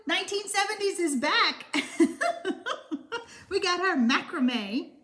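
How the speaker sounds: laughing. Real, not acted. Laughing about seventies trend.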